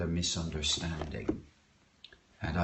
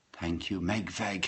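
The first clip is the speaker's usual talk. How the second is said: normal talking voice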